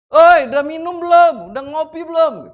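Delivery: shouted invitation. Acted. Hill dweller affectionately offering coffee, shouting. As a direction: call out as if to someone far away. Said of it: "Ngajak minum kopi sampai teriak-teriak begitu".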